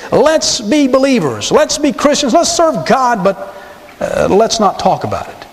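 mock compromise. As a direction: sound pleasant then let it fade.

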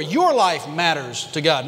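YOUR slowed and leaned on before "life matters to God"; stress on YOUR. Shown as a demonstration. Pastor to the congregation, YOUR weighted and slow. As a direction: press hard on the word YOUR. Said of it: "the emphasis is on the word your".

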